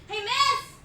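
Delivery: man yelling after women outside a club; mock yell. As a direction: shout across the street to get attention.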